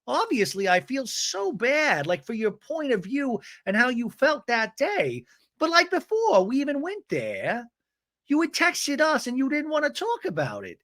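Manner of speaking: high-pitched insistent imitation; imitated earnest argument. Voices Gina arguing with Heather.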